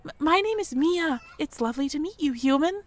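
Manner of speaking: high, timid little mouse voice; a nervous young mouse working up courage; shy mouse girl. Acted. Mia the mouse approaching the human stranger, timid and high.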